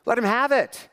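urging him on. Retold or imitated. Pastor urging student to yell.

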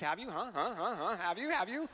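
rapid-fire needling questions, each tipping upward; hammed-up nagging interrogation; mock pestering. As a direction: badger them like a pushy fixer, played for laughs. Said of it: "Say things like".

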